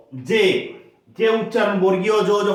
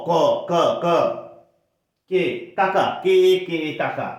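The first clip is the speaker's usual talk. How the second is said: normal talking voice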